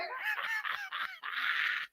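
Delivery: quiet breathy high laugh; soft high laugh. Real, not acted. Remembering the fight, quiet airy laugh. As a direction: chuckle at how it turned out.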